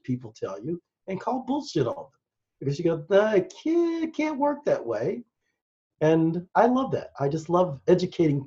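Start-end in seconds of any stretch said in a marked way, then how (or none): 3.1-5.2 high-pitched mock disbelief; skeptical dismissal. Educated listener calling bullshit on what people tell them, skeptical. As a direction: wave it away in a higher, lighter voice.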